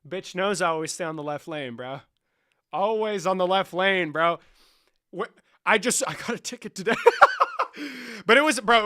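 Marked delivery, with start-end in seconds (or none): 2.7-4.4 emphatic boast. Real, not acted. Emphatic proud claim. 6.1-8.8 loud high burst of laughter mid-sentence; cracking up while talking; laughing. Real, not acted. Laughing about ticket.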